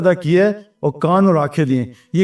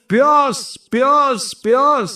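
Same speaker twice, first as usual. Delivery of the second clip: each word pitched much higher and dragged out with dry longing; parched thirst; 7 semitones higher, much slower than usual. Acted. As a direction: act out a thirst that will not quench. Preacher acts out parching thirst.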